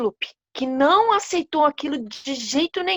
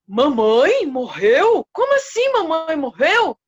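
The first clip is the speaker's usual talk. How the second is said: slow and louder in a changed voice, rising into the second question; stunned disbelief; 3 semitones higher, 5 dB louder than usual. Acted. King Leopard hearing his mother died, stunned and louder. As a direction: let the shock stretch every word.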